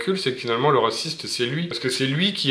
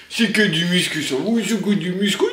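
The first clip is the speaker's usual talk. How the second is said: high-pitched gushing to a dog; squeaky pet-owner voice; gushing dog talk; 6 semitones higher than usual. Real, not acted. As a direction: coo excitedly at your dog in a silly high voice.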